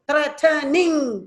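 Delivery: THREATENING slowly as threa, te, ning; THREATENING stretched into three even syllables. Shown as a demonstration. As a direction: sound out THREATENING in three beats. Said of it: "three syllables".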